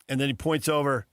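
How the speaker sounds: usual speaking voice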